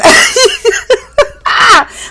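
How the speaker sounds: shrieking high laugh. Real, not acted. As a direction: burst into a squealing cackle. Reacting to the shade.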